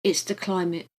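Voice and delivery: ordinary delivery, nothing marked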